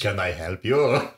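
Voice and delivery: slow, put-on French accent; mock French accent. Imitating the French Canadian clerk, mock French accent. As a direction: ham up a French-accented offer of help. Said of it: "What's a French".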